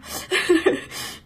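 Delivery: high breathy laugh; airy laugh. Real, not acted. Reader laughing at her strange channeling. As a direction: laugh at how odd it felt.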